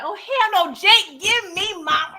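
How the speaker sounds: pissed off, yell for your money fast; angry demand. Retold or imitated. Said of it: "Alonzo is pissed off and stressed out".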